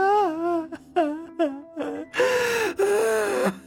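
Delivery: high, drawn-out broken sobs; anguished sobbing. Acted. Wife weeping for her granddaughter, sobbing in anguish. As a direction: break down into long, high-pitched weeping. Wife breaks down after lament.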